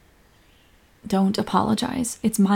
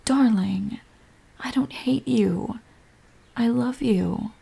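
hushed, heartfelt affection; tender reassurance; slower than usual. Acted. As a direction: reassure your partner lovingly. Reassuring partner of love.

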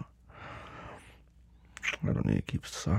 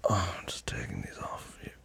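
whispering; 9 dB quieter than usual. Acted. Undressing partner in intimate scene.

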